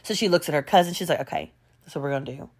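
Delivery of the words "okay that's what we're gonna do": quick, hushed planning; low conspiratorial aside. Retold or imitated. Cousins plotting over the gum, hushed. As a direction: say it under your breath, fast and low.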